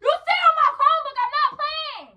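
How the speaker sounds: very high, heated shout; shrieked scolding in a quarrel; angry shouting. Acted. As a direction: shout a furious warning. Prank quarrel over the Chromebook.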